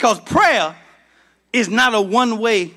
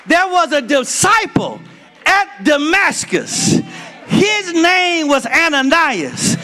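preaching at full force; 7 semitones higher than usual. Acted. Pastor rousing the church about Ananias, full preaching shout. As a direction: whoop it up for the congregation.

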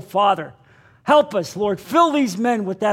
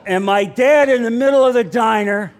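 usual speaking voice

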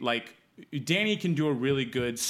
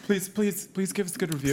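higher-pitched playful begging; mock pleading; 4 semitones higher than usual. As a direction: beg the listeners, half joking. Begging listeners for reviews.

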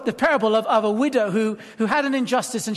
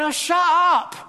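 high, loud crowd heckle; impatient shout of an irritated crowd; rude shout; 5 semitones higher, 4 dB louder, slower than usual. Acted. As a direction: yell it impolitely to silence someone. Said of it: "Were they that polite? Probably not".

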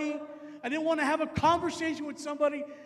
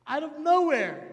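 usual speaking voice

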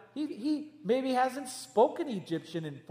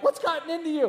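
higher, louder puzzled question; incredulous; 8 semitones higher, 4 dB louder, faster than usual. Retold or imitated. Family asking the niece what happened to her. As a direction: ask it as if she were an alien. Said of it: "looking at her like she's an alien".

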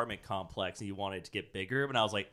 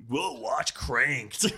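monster truck ad; 3 semitones higher, much slower than usual. Pretend. Slow ad parody.

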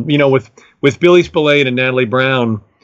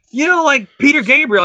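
a much higher friend voice offering another name; imitating his friend Dan; 14 semitones higher than usual. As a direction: prompt him again, hopeful. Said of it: "And he's like".

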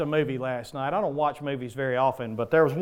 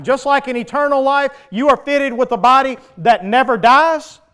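loud, higher fire-and-brimstone preaching; full-force preaching; 7 semitones higher, 11 dB louder than usual. Acted. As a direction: thunder it out to the congregation.

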